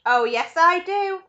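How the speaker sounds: loud, high, slow and savoured; gleeful insistence. A narrator's reading. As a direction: confirm it with relish. Wonka gleefully confirms his plan.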